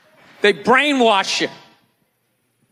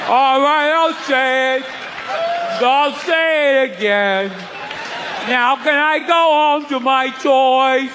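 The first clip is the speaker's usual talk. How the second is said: put-on slow, whiny, higher child voice; bored kid; 3 semitones higher than usual. Kid in church grudgingly repeating words, slow and whiny. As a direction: drag it out like a sulky kid.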